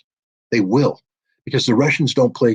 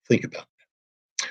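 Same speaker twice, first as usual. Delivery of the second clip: breathy whisper, much softer and slow; whispered warning; 8 dB quieter, slower than usual. Real, not acted. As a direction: lean in and whisper it like a grim secret. Ominous aside after Putin quote.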